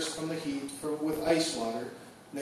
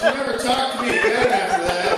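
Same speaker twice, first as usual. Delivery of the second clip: laughing through the line; cracking up at his own joke; laughing while telling it; 5 semitones higher than usual. Real, not acted.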